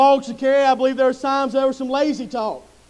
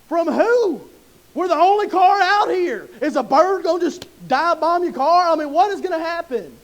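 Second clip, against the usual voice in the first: higher-pitched, exasperated disbelief; mock incredulous; 3 semitones higher than usual. Son answers dad's door-ding worry, mock incredulous. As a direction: protest it as if the excuse is absurd.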